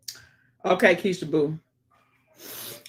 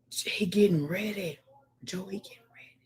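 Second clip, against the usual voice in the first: whisper; 7 dB quieter than usual. Shown as a demonstration. Whispered repeat of a comment.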